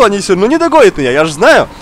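ordinary talk

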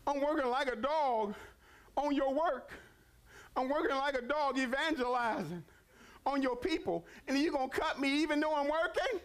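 high, impassioned complaint to God; rising preacher's protest; preaching protest. Acted. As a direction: plead like an overworked servant. Preacher voices worker protesting God.

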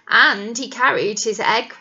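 plain reading voice

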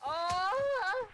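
long, high, loud wail; drawn-out high yelp; pained yell. Real, not acted. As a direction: cry out as if just hit. Reacts to being lasered.

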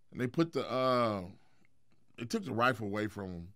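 ordinary delivery

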